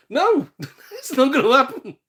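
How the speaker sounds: loud, high-pitched refusal through a laugh; incredulous scoffing. Real, not acted. Telling game collectors their retirement plan fails, incredulous. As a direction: shoot it down, laughing in disbelief.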